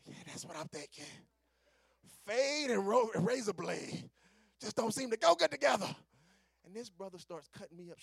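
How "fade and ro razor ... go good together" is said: loud, raised-pitch comic protest; big indignant punchline shout; incredulous outburst. Acted. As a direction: holler it in disbelief. Incredulous outburst about the blade.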